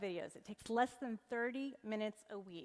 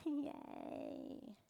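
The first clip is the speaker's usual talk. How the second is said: small, higher mock cheer; sarcastic cheer; 5 semitones higher, 4 dB quieter than usual. Speaker pretends to celebrate easier AI content, sarcastic cheer. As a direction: cheer half-heartedly in a put-on voice.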